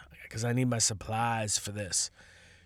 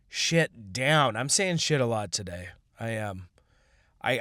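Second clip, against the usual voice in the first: ordinary talk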